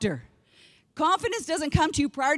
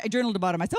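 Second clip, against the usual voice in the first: ordinary talk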